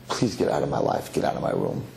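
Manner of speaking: low, mildly fed-up brush-off; weary dismissal. Retold or imitated. Boy to old man before his wedding, weary dismissal.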